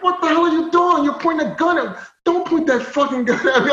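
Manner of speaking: high-pitched outraged retold yell; angry yelling. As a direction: act out yelling in protest at drawn guns. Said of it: "I'm still yelling at them".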